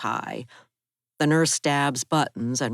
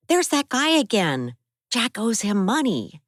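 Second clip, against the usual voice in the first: put-on higher young woman's voice, upset; raised, higher-pitched Abby telling of the break-in; Abby's voice; 9 semitones higher than usual. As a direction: voice Abby, shaken by the attack.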